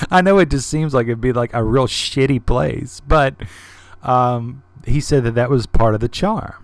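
ordinary delivery

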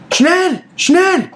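high, loud barked German orders; shouting German soldier. Acted. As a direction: yell it like a frantic German guard. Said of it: "you hear the German say".

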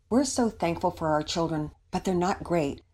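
plain reading voice

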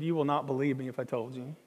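low, hushed admission; imitating a quiet, hesitant reply; retold reluctant confession. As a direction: confide it softly, as if unbelievable.

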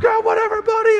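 high, loud dismissive sneer; hammed-up rude driver, high and loud; mocking other driver. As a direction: brush him off in a high mocking voice. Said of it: "So then the guy's like".

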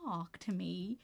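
slow, hushed mock whisper; imitated whisper. Friend whispering to companion during the film, whispered. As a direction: whisper it as in a cinema. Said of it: "I was whispering".

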